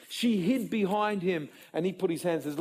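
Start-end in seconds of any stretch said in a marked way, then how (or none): none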